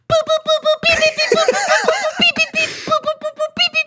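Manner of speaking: very high laughing beep boop; robot beeps. Retold or imitated. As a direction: squeak out laser beeps. Imitating space metal sounds.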